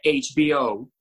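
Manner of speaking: "H B O" spelled out with English letter names; "H B O" in English inside Spanish; English pronunciation. Shown as a demonstration. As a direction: say "H B O" as aitch-bee-oh. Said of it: "uno pronuncia todo en inglés".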